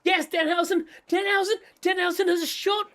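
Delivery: high excited fan cheer; fan marking out. Retold or imitated. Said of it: "suddenly go".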